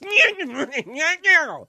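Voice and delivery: high, loud showy camp squeal; affected squeal. Retold or imitated. Mocking showbiz affectation with a loud high squeal. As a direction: shriek like a showbiz luvvie.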